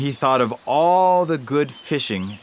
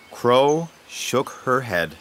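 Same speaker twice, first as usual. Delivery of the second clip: usual speaking voice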